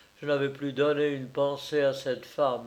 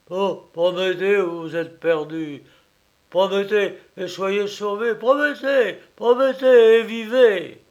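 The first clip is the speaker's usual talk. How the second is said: building from pleading to a loud high cry; louder and higher with each promettez; desperate plea; 6 semitones higher, 10 dB louder than usual. A narrator's reading. Aunt's last plea to nephew, rising to a loud desperate cry.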